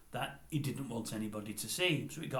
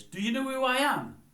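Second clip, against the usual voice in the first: loud, high, puffed-up challenge; arrogant boxer; 13 semitones higher, 7 dB louder than usual. Retold or imitated. Gary Stretch demands the customers recognise him, loud and arrogant. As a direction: put on a mocking swagger. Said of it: "And Gary Stretch says".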